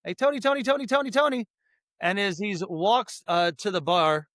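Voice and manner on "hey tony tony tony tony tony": calling out. Retold or imitated. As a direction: call his name across empty rooms. Searching house calling for Tony.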